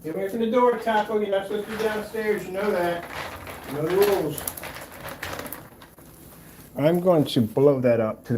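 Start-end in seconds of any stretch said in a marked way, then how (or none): 0.0-4.4 scolding the dog. Real, not acted.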